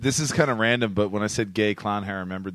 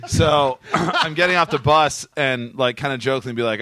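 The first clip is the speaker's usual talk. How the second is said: normal talking voice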